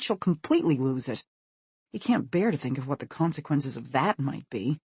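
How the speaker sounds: ordinary delivery, nothing marked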